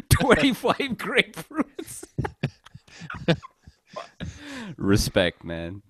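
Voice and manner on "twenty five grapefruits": laughing. Real, not acted. Laughing at absurd grocery order.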